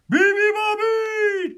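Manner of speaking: high, loud, slow blare; long blaring high call; trumpeting elephant. A narrator's reading. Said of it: "trumpeted the elephant".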